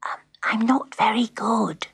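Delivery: put-on hesitant child's whisper, pitched high; nervous whisper. A narrator's reading. Pat confessing to the grand piano, whispering nervously. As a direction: whisper it timidly. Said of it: "she whispered to the grand piano".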